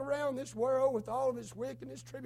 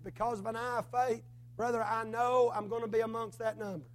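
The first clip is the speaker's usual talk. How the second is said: ordinary delivery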